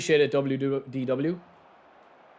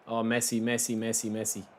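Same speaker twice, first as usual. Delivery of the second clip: chanting the name softer each time, trailing off; willing the player on, fading to nothing; fading plea; 5 semitones lower, 6 dB quieter than usual. Real, not acted. Gamer begging his player on screen, fading plea.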